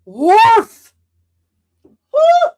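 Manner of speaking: high, loud fan shriek; excited scream. Real, not acted. Fan spotting her favourite Klingon, screaming with joy. Fan sees Worf in trailer.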